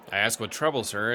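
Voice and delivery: ordinary talk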